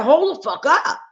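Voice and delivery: high, loud retold outburst; mock furious stop; angry retort. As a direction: snap back at her in disbelief. Retold angry confrontation with caseworker.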